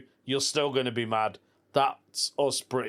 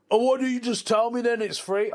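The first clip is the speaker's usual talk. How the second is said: annoyed gym guy; 9 semitones higher than usual. Retold or imitated. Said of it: "And then he went".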